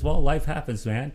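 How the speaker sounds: ordinary talk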